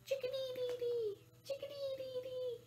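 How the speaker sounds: high sing-song chickadee call; imitating a chickadee. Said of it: "Chickadee is an acrobat".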